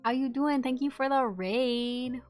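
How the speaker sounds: high, loud, stretched-out greeting; excited raid welcome. Real, not acted. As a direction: welcome the raiders in, big and drawn out. Greeting an incoming raid on stream.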